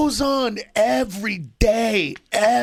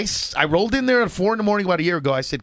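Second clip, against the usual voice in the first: ordinary talk, nothing marked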